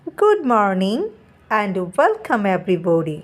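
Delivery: teacher's greeting read very slowly; slow dialogue reading. Shown as a demonstration. Teacher reading the textbook dialogue to the class, slowly. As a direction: spell out the greeting for learners. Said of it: "এখানে teacher বলছে".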